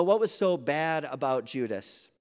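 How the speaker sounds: usual speaking voice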